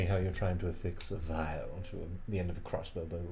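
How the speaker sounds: low, ominous bargaining voice; demonic deal voice. Acted. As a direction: speak like a demon offering a deal. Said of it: "demonic deal voice".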